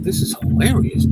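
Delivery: put-on a light whisper of an old woman's glee; whispered mock delight. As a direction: hiss it like the giggly lady in the seat. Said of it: "I hear her going".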